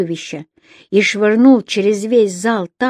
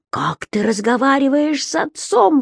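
frightened exclamation; 5 semitones higher than usual. Acted. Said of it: "воскликнул он".

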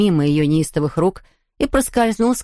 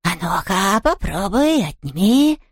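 taunting ghost; slower than usual. A narrator's reading. Hugo dares the baroness to take her head, teasing.

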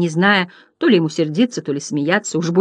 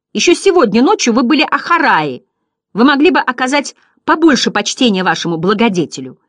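raised, indignant declamation; Bastian angry; 6 semitones higher, 4 dB louder than usual. A narrator's reading. Boy scolding the butterflies he made, indignant raised voice. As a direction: scold them in a higher, emphatic voice. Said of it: "по-настоящему рассердился Бастиан".

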